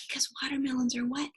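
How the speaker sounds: ordinary delivery, nothing marked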